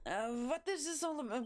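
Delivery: put-on German accent. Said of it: "Why is she German?".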